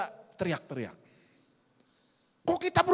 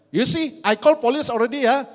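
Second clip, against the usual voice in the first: loud, raised mock threat; acted teacher's warning; 6 semitones higher, 8 dB louder than usual. Teacher bluffs children about calling police, loud acted warning. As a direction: bluff the children with a high, forceful voice.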